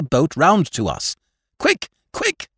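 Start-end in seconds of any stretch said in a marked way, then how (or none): 1.6-2.5 high, urgent yell; urgent shout. Acted. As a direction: scream for the crew to hurry. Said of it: "I shouted".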